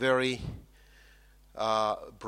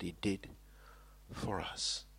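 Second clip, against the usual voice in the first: whisper; 4 semitones lower, 12 dB quieter than usual. Real, not acted. Hushed ending of sentence.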